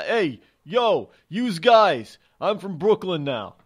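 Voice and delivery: put-on Brooklyn accent, loud and slowed; hammed-up Brooklyn tough guy; New York accent. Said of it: "I sound like I'm from New York City".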